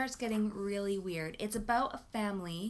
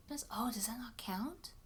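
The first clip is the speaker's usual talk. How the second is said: hushed, sneaky whisper, then quick and softer; guilty whispered aside; 7 dB quieter than usual. Real, not acted. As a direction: whisper it like a little confession you're getting away with. Guilty aside about a gifted book.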